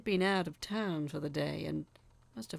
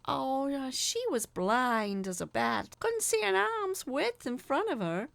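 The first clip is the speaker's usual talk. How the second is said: Doreen explaining; 7 semitones higher, 4 dB louder than usual. A narrator's reading. Doreen explaining the blind aunt, offhand higher voice. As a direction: brush it off lightly.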